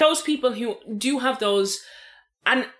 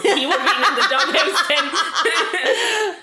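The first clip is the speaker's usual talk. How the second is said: laughing; 6 semitones higher, 3 dB louder, much slower than usual. Real, not acted. Laughing joke.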